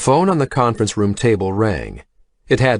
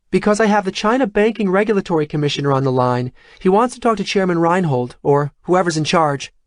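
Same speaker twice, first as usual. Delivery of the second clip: put-on young assistant; 10 semitones higher than usual. A narrator's reading. Tanya calling from hallway.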